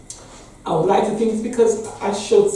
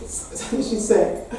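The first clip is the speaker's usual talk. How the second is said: ordinary talk, nothing marked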